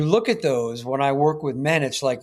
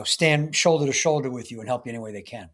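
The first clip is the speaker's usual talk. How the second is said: normal talking voice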